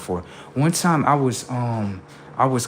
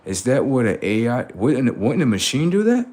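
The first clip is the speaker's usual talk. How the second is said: ordinary delivery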